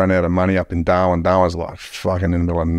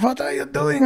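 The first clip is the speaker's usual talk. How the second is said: fake Swedish tourist. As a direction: play a startled Swedish backpacker protesting the arrest in a high voice. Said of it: "I'm from Sweden".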